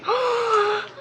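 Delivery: long, high intake of breath; gasp, overcome with anticipation; excited gasp. Real, not acted. Slime fan too shaky to open the lid, excited gasp.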